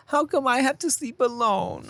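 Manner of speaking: put-on high, slow small-child voice; plaintive imitation of a young girl; little daughter's question. Daughter asks dad about sleeping alone, plaintive child impression. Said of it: "she was like".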